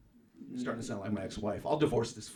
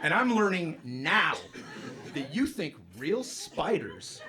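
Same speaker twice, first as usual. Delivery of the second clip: loud, high, slow disbelief, fading down; incredulous shout; 8 semitones higher, 7 dB louder, much slower than usual. Retold or imitated. Husband shouting at ex-wife in disbelief, loud and slow. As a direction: spell out the outrage slowly.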